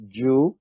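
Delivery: "ju" with a long U, as in "rude". Shown as a demonstration. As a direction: say "ju" to rhyme with "food". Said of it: "as in long U".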